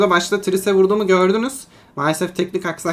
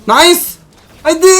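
much higher and louder, triumphant yell; excited yell; 11 semitones higher, 10 dB louder than usual. Real, not acted. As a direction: scream it as the fight turns. Clutch moment in teamfight.

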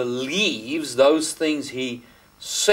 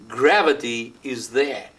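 ordinary talk

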